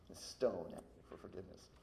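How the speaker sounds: ordinary talk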